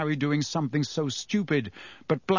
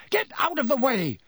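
shouting; 10 semitones higher than usual. A narrator's reading. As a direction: shout the order to clear the way. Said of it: "he shouted at Ron and Hermione".

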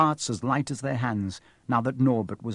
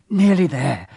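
louder, panting with effort; panted; 4 semitones higher, 6 dB louder than usual. Acted. Harry hauling the crate up stairs, panting. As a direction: gasp it out while hauling the crate. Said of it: "Harry panted".

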